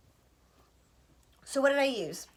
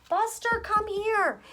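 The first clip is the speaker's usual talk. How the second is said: sudden high call to the dog; high pet call; 10 semitones higher, slower than usual. Real, not acted. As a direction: break off to call him in a high voice. Calling dog mid reading.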